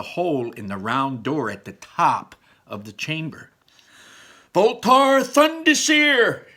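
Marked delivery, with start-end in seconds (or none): 4.5-6.5 high, loud, slow magic shout; spell shout. Acted. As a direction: bellow the spell out long. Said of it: "Ian shouted".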